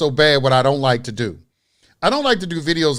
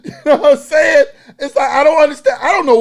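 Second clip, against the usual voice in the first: louder, higher, laughing through the words; 8 semitones higher, 6 dB louder, faster than usual. Real, not acted. Creator giving up on explaining to his viewers, laughing exasperation. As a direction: talk through real laughter.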